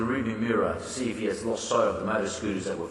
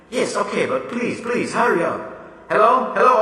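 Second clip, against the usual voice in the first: higher, louder urging to hurry; impatient pleading; 9 semitones higher, 5 dB louder than usual. Acted. Miss Tyler pressing the operator, impatient and loud. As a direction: push them to hurry, anxious.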